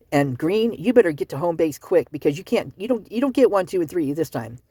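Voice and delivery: usual speaking voice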